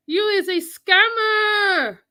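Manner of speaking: slow, loud, high yell at him; loud slow scolding yell; scolding shout. Real, not acted. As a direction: shout the insult, drawn out. Insulting scammer.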